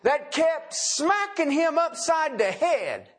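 high, slow, pressing emphasis; emphatic preaching. Real, not acted. Preacher to congregation, slow high emphasis. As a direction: drive the image home slowly.

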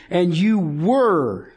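slow lead-in to a long "were"; heavy, lengthened "were"; emphasis on were. Shown as a demonstration. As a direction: draw out "were" when reading the verse. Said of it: "the emphasis is on the verb".

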